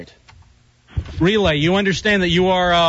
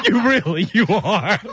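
talking through laughter; real laughter; 4 semitones higher, slower than usual. Real, not acted. Host cracking up at the prank caller, laughing. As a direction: laugh helplessly between the words.

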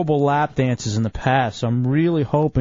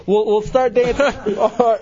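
laughing; 9 semitones higher, 4 dB louder than usual. Real, not acted. Lap dance starting, laughing.

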